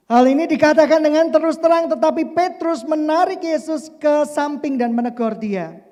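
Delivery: loud, high preaching voice; forceful scripture reading. Acted. Preacher proclaiming the Peter passage to the congregation, forcefully. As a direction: read it out with full conviction.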